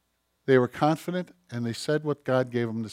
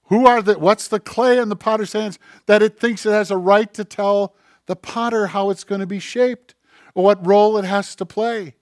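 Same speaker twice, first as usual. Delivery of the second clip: normal talking voice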